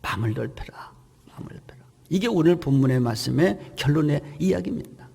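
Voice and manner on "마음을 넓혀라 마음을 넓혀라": a hushed whisper, fading out. Acted. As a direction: let the phrase die away in a breath. Repeated key line whispered. (속삭이듯 점점 사라지게)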